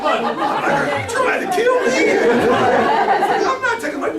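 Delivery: high, slow, laughing through the words. Real, not acted. Guest recalling the executive's money dare, laughing hard. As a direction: squeeze it out between laughs.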